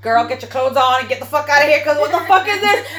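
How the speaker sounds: loud mock telling-off; irate dismissal. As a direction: order her out, rising in disbelief. Retold telling-off of the other woman.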